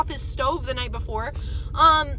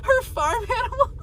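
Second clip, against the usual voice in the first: high, teary laugh breaking into a squeal; laughing through tears at her own fate; laugh-crying; 13 semitones higher than usual. Real, not acted. Joking she'll end up alone, laughing through tears.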